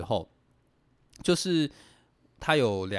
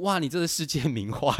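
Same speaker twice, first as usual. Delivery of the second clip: lightly voiced, a bit higher, over-the-top teacher praise; a hint of an exaggerated teacher's gushing; 4 semitones higher than usual. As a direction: hype up a child's drawing like a doting teacher. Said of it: "就会讲了一副".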